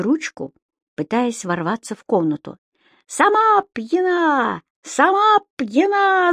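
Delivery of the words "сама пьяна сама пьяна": very high, stretched-out taunting cry; gleeful yelling. A narrator's reading. Imp jumping for joy on the wardrobe, gleeful yell. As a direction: crow it back slowly in a high voice. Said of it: "закричал Субастик и от радости запрыгал".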